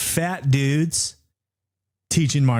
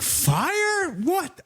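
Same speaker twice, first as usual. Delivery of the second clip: high, incredulous falsetto question; mock alarm. Streamer hears of a fire, high startled disbelief.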